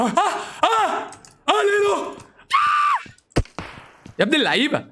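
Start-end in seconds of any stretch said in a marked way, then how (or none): 0.0-3.1 very high-pitched shrieks; drawn-out squealing scream. Real, not acted. Screaming as he dies in game.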